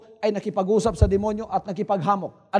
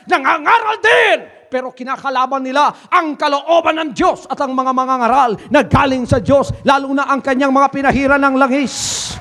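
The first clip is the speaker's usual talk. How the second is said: fiery preaching; 3 semitones higher, 4 dB louder than usual. Acted. Preaching scripture at full force.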